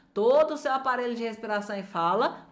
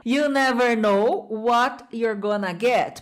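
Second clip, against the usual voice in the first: slow, careful model of the clip line; slow repeat; 3 dB louder, slower than usual. Shown as a demonstration. Teacher modelling the film line slowly for students. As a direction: say it slowly for learners to copy. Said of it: "Olha pra frase amarela".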